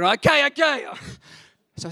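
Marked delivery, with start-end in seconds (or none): 0.0-0.9 high, loud, exasperated surrender; exasperated giving-in. Retold or imitated. Pastor giving in to the nagging voice, loudly exasperated. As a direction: cave in loudly at high pitch.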